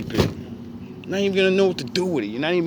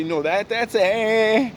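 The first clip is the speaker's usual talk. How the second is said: high, slow, whiny critic voice; honest listeners; 6 semitones higher, 7 dB louder, slower than usual. Retold or imitated. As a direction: act out the unimpressed crowd, high and drawn out. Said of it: "majority of people say".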